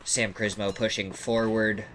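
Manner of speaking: ordinary talk, nothing marked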